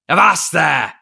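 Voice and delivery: pirate captain's loud command; sharp cry. A narrator's reading. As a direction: stop him dead with it. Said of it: "cried Silver".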